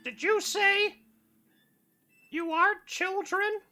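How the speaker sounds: very high, slow sinister squeak; creeping cartoon menace; menacing mouse voice. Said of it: "stalking forward".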